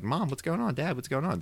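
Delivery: lightly voiced, high worried kids; mock kids. Host imitating kids asking their fighting parents, lightly childish.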